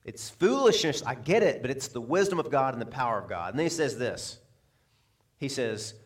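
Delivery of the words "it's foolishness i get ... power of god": ordinary talk, nothing marked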